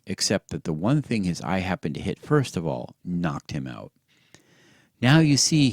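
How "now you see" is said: loud, breathless excitement; out of breath. A narrator's reading. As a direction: gasp it out, breathless. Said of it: "all out of breath".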